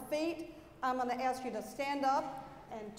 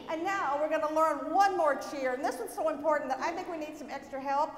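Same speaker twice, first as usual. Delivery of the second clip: ordinary talk, nothing marked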